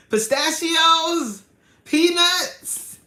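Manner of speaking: high, loud, laughing mimicry; cracking up while mock-shouting someone's blunder; imitating his wrong guesses. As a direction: belt out each silly guess through laughter. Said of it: "he was like".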